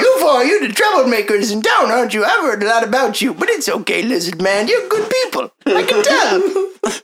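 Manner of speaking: put-on high little old shopkeeper voice; tiny old man voice. Shopkeeper speaks in character.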